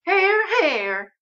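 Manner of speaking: very high, drawn-out cheer of agreement; second it in a high character voice; Eunice. A narrator's reading. Guest agrees with the aunt, in high character voice. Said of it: "says Eunice".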